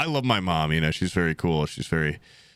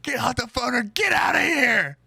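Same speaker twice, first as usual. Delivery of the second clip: mock shout; 13 semitones higher than usual. Wife yelling at husband on phone, mock shout. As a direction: scream it like an angry wife. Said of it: "She's like".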